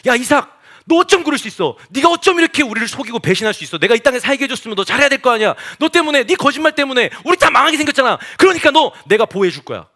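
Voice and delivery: exasperated royal rebuke; indignant king. Acted. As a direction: act out a king berating his subject. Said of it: "왕의 어명을 내려서 이렇게 얘기하는 거예요".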